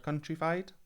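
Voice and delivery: rising, unsure "countrified?"; questioning the word. Real, not acted. Coach doubts her own word, asking with a rising tune. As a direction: ask if "countrified" is even a word. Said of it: "If that's the word".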